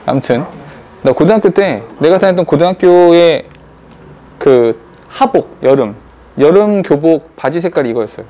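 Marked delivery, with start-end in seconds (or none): none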